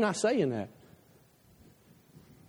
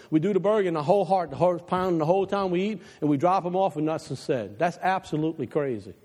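ordinary talk, nothing marked

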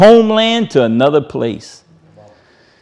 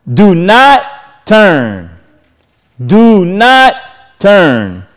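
high, ringing recitation of Joshua; solemn preacher's scripture read; 3 semitones higher, slower than usual. Acted. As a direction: proclaim the verse like a charge from the pulpit.

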